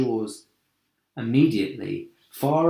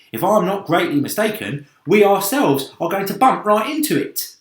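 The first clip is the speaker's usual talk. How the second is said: sharp; 7 semitones higher, 6 dB louder than usual. A narrator's reading. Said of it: "the centipede said sharply".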